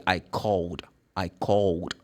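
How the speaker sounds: "called" as one syllable ending in a soft D; voiced D ending. Shown as a demonstration. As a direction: say "called" low and measured, ending kawld. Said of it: "it's gonna be D".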